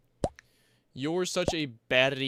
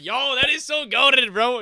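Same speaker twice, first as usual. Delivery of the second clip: much higher and louder hype; hyped; 10 semitones higher, 8 dB louder than usual. Real, not acted. Streamer thrilled Mandarin has no conjugation, loud hype. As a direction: gush like it's the best news ever.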